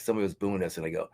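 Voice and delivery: usual speaking voice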